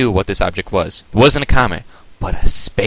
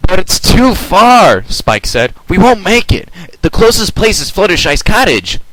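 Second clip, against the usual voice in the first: worried Spike; 10 semitones higher, 5 dB louder than usual. Acted.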